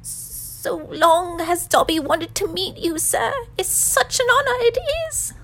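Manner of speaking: high, loud, gushing house elf; high-pitched creature voice. A narrator's reading. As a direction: gush with honor in a high elf voice. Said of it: "said the creature in such a high-pitched voice".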